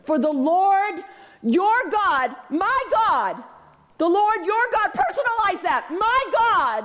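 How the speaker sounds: preacher climbing to a peak; rising preaching. Acted. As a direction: build the pitch phrase by phrase. Building preaching climax.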